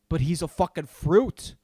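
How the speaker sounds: ordinary talk, nothing marked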